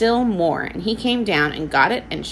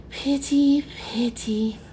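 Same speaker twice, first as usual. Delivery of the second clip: put-on baby voice; 7 semitones higher, slower than usual. A narrator's reading. Baby talking to seaman.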